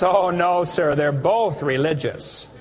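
ordinary talk, nothing marked

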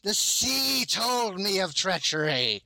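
a touch of righteous fury; mildly fervent accusing priestess; accusing cry. Acted. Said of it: "she says".

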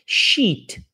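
"Sheet" with a long stretched ee. Shown as a demonstration. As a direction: hold the ee in "Sheet". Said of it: "sheet é lençol".

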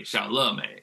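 lazy southern "le" in "xiao le mei"; Taiwanese xiao le mei. Shown as a demonstration. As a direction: Taiwan pronunciation, tongue falls out. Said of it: "it's like the tongue falls out".

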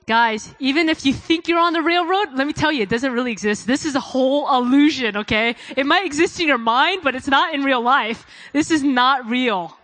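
full-force preaching. Acted. Preacher urging the congregation to surrender, loud and fervent. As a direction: preach it hard to the crowd.

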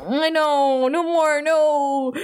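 high, drawn-out grossed-out whine; playful pleading whine; mock disgust. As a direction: join in pretending to be revolted.

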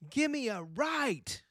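high, slow cheerleader chant. Acted. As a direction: call it out like a cheerleader. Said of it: "pretend I'm a cheerleader".

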